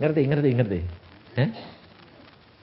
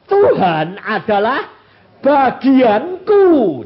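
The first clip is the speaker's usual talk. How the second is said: slow, loud, higher, each word drawn out; proclaimed verse; 8 semitones higher, 9 dB louder, much slower than usual. Acted. Preacher proclaiming the verse to the congregation, slow and loud.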